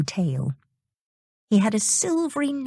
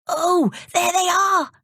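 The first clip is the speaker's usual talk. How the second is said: put-on much higher, slow, delighted boy's cry; excited cry; 13 semitones higher than usual. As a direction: marvel at spotting the sea horses. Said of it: "cried Dick".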